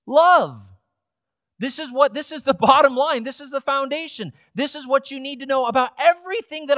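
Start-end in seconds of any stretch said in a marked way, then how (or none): 0.1-0.6 loud, punched single word; emphatic proclamation. Real, not acted. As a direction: proclaim it forcefully to the congregation. Preacher repeats the central command.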